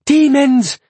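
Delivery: high terrified shriek. A narrator's reading. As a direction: scream it out in panic. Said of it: "the woman shrieked".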